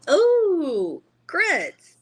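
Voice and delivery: thrilled at finding grits; excited. Real, not acted. Delighted to find grits.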